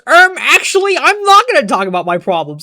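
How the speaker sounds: high nerdy "um, actually" then a fast dismissive rattle; mock Jax as a smug know-it-all; snooty mock refusal. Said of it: "Jax goes".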